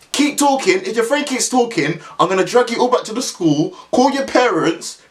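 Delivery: high, stern threatening voice; mock threat. Caretaker threatening the boys, high imitated stern threat. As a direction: threaten to drag them back to school.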